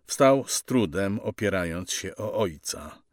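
plain reading voice

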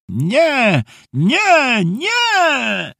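high, drawn-out mock wail; grandpa's theatrical mimicry. A narrator's reading. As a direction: mimic a boy's whining refusal theatrically. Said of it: "Dziadek wykazał się aktorskimi umiejętnościami". (teatralne, wysokie przedrzeźnianie wnuka)

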